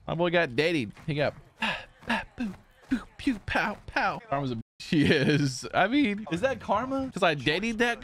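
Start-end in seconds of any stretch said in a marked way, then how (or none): 2.4-4.2 mouth gunshot noises. Retold or imitated. Imitating gunshots.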